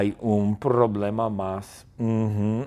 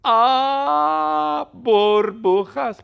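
high, loud, drawn-out cry of wonder; screaming; 12 semitones higher, 6 dB louder, much slower than usual. Acted. Susana on LSD screaming at bubbles, high loud shriek. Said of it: "Susana grita".

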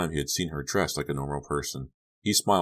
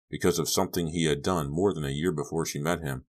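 usual speaking voice

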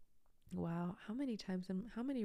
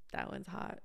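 breathy little aside; whispered aside; 5 dB quieter than usual. Real, not acted. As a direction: murmur it under breath.

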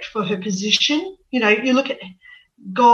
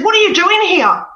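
sharp, loud, high angry challenge; angry demand; 9 semitones higher, 6 dB louder than usual. Retold or imitated. Angry spirit woman demanding why she is there. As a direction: snap at her like a furious ancestor. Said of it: "she was very angry".

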